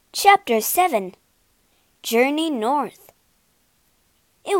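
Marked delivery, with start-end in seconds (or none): none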